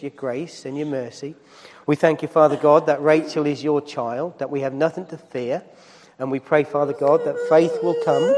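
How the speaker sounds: spoken prayer, measured and earnest. Acted. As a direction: pray over her, steady and sincere. Leads a prayer for Rachel.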